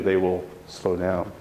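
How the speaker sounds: ordinary talk, nothing marked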